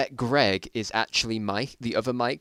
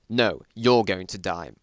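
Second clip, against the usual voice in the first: defiant retort; 3 semitones lower, 7 dB louder, slower than usual. Acted. Hero defies villain.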